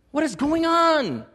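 high, drawn-out mock exasperation; exasperated outburst. Frustrated believer demanding answers from God, hammed-up exasperation. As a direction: wail it high in frustration, stretching the words. Acting out a frustrated person's complaint.

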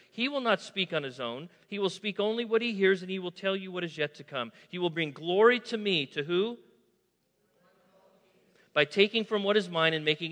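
loud, rising scripture reading at full force; preaching scripture. Acted. Preacher reading Jesus' words to the church, loud and fervent. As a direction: proclaim it to the congregation.

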